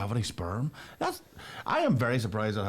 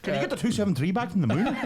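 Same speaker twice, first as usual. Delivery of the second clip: ordinary talk, nothing marked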